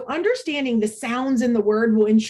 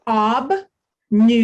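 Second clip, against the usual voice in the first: "Ob, nu" as separate syllables, a clear pause after each; 5 dB louder than usual. Shown as a demonstration. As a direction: model "Ob", then "nu", one at a time. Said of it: "tell you the syllables in obnubilate".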